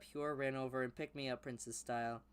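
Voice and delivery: ordinary talk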